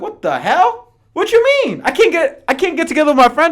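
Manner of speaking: outraged disbelief. Retold or imitated. Boston people told they can't meet friends: high shocked protest. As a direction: squeal it, appalled. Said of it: "people in Boston are like".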